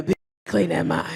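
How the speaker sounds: mock deep macho voice; deep dude voice. Comic imitating a man's deep voice. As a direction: drop into a fake bass. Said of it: "They voice ain't even normally that deep".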